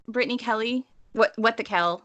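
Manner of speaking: ordinary delivery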